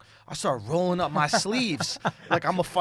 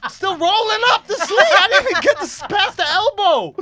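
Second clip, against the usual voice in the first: laughing; 12 semitones higher, 7 dB louder than usual. Real, not acted. Laughing retelling.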